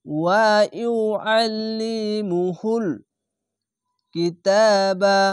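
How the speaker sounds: slow, drawn-out chanted recitation; Quran recitation. Shown as a demonstration. Teacher to students, slow drawn recitation. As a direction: recite it slowly with tajweed. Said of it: "Quran recitation demo".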